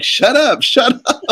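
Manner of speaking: high-pitched mock dad yelling shut up; dad snapping shut up. Retelling dad at the movie line, high mock snap. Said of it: "My dad's like".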